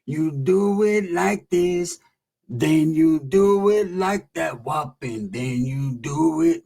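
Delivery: slow, drawn-out gangster guru; OG homie. Retold or imitated. OG urging narrator to get rededicated, very slow chant-like drawl. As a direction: stretch every word out like a solemn OG.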